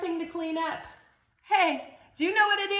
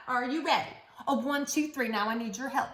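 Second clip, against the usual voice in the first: usual speaking voice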